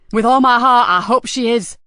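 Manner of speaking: furious boss, starting loud; spitting anger. A narrator's reading. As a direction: spit it out with full bitterness. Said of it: "spat Nikki".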